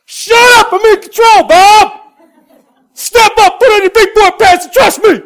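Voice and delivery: high, loud shouted command; God shouting at Bob like a drill sergeant; God yelling. Retold or imitated. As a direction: bellow at a stubborn Marine. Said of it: "With me".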